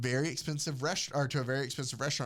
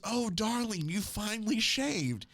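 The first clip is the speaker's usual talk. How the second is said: higher, slow gushing darling; mock gushing wife; 7 semitones higher than usual. Wife delighted husband shaved, higher mock gush. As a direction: swoon over the shave, lightly hammed. Acted melodrama wife quote.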